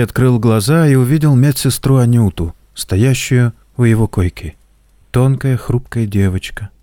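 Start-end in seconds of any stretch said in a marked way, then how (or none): none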